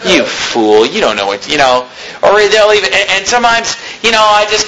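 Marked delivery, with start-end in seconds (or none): 0.1-1.5 low, slow sneering contempt; lightly imitated disdainful sneer; mock scornful glare. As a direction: voice a visitor's silent scorn, low and drawn out. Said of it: "making a face like".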